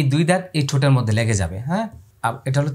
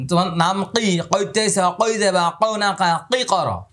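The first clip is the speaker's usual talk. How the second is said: lightly imitating old-style teachers, raised; mild mock of the old way of teaching; a hint of an old-fashioned teacher; 5 semitones higher than usual. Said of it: "এরকম উচ্চারণ উস্তাদরা পড়াইতো".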